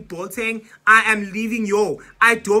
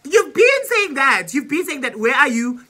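frustrated scolding; 6 semitones higher, 7 dB louder than usual. Retold or imitated. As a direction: scold him for stalling. Scolding dad on phone.

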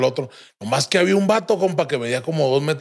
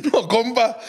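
usual speaking voice

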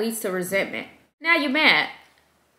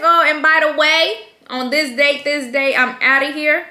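higher, louder gleeful sign-off; excited announcement; 6 semitones higher, 8 dB louder than usual. Retold or imitated. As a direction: announce your day off with glee. Announcing her own day off.

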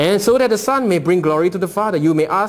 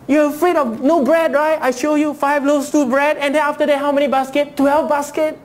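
preaching as Jesus; 8 semitones higher than usual. Acted. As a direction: proclaim it as Jesus himself, rising in fervor. Preacher voicing Jesus at full force.

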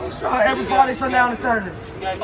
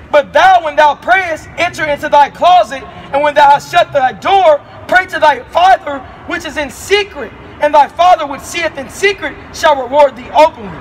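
louder scripture preaching at the street; 5 dB louder than usual. Acted. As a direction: proclaim it to the passer-by. Street preacher reads scripture aloud.

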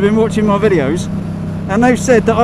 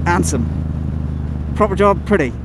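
a light Cornish twang, low and easy; understated Cornish accent; Cornish phrases; 7 semitones lower, 4 dB quieter than usual. Shown as a demonstration. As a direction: drop into Cornish for the local sayings. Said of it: "'Cause I do talk a bit Cornish".

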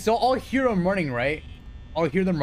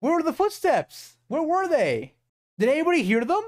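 frantic disbelief; 8 semitones higher than usual. Real, not acted. Protesting the unfair death.

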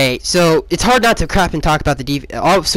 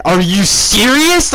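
drawn-out, higher yell of disbelief; retold yell; 5 semitones higher, slower than usual. Fan reliving his live reaction, retold yell. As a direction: stretch SERIOUS in a mock yell. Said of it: "I, I yelled that".

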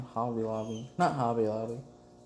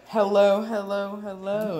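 cheery greeting; 6 semitones higher, 4 dB louder than usual. Retold or imitated. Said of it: "You all were like".

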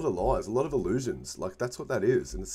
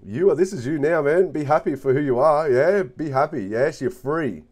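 loud, higher, bursting with uplift; excited encouragement; 6 semitones higher, 9 dB louder than usual. Real, not acted. Tarot reader cheering on the querent, excited and loud. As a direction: pump them up, full of joy.

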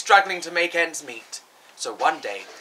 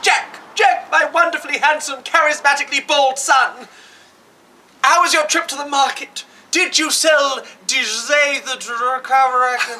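much higher, louder gushing dame voice; panto dame mum; 8 semitones higher, 4 dB louder than usual. Acted. Mum greeting her son at the door as a high panto dame. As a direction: gush over him like a panto dame. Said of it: "his mum greeted him".

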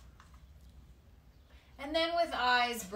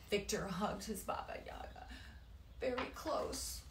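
tender whisper; 8 dB quieter than usual. A narrator's reading. Tender moment in the story.

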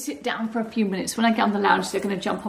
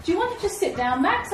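much higher questioning call; calling the dog; 9 semitones higher than usual. Real, not acted. Owner calls her whippet Max, much higher. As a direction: lift it up high to coax him.

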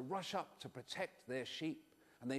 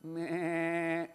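a drawn-out sheep meh; sheep bleat. Acted. Boy imitating the sheep for the children, bleating. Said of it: "Do you know what sheep do?".

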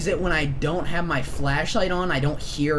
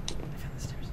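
whispered; 13 dB quieter than usual. Real, not acted. Whispered relief in horror game.

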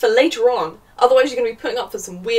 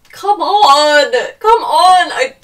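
loud, high cry of disbelief; loud, pained protest; exasperated shout; 7 semitones higher, 12 dB louder, much slower than usual. Real, not acted. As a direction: yell in real exasperation at the page. Exasperated reaction to cringy dialogue.

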